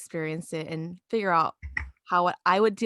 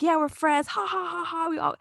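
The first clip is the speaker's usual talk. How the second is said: higher, put-on giggly voice mocking the clique; obnoxious mock cliquey voice; 8 semitones higher than usual. Coworker mocking the cliquey friends, obnoxious higher fake-laugh voice. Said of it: "a little bit obnoxiously".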